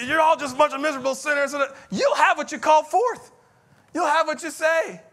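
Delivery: high-pitched mock preacher's shout; hellfire preacher. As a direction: thunder at the sinners in imitation. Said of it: "there's a preacher that's preaching hell".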